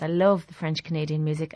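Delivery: ordinary talk, nothing marked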